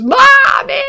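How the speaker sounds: high, loud cry of Mommy; child's desperate cry. Retold or imitated. Toddler being peeled off his mother, screaming. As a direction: wail for mom as you're pulled away. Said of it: "they literally had to peel him off of me".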